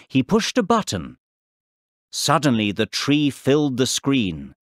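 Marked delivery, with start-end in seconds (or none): none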